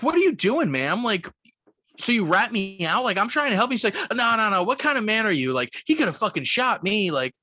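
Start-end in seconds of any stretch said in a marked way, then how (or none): 0.0-3.8 high, incredulous retold comeback; exasperated protest. Clerk confronting the guest who ratted them out, high and exasperated. 4.1-7.1 high-pitched, agitated retold reproach; outraged scolding. Guest berating the clerk over a danger, high and outraged.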